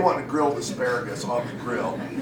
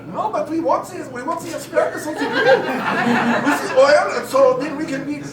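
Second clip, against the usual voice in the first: put-on high-pitched German-accented relatives; slight German accent; 11 semitones higher than usual. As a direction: lay out rules in a high, earnest German accent. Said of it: "slightly a German accent".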